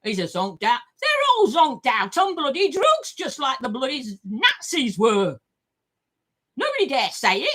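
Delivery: ordinary talk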